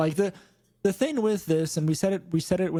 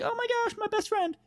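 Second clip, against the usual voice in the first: put-on gushing girly voice. Voicing Carmine gushing.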